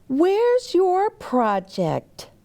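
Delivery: a lightly voiced girl asking, high and slow; slow high question. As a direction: lift the pitch high and ask slowly like a curious girl. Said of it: "Mary Ann asked Sam".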